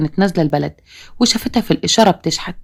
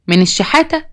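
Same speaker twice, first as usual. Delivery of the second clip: high incredulous rise on الشحاتة; shocked disbelief; 6 semitones higher than usual. Acted. Mother shocked her daughter is begging, incredulous rise. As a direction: echo the word back in disbelief.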